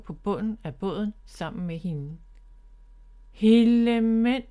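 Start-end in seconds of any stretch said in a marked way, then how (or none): 3.3-4.4 loud astonished "Hellemænd"; exclamation. Acted. Bob lands in the boat beside Miffy, loud astonished exclamation. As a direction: blurt it out, thrown off balance. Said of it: "udbrød han".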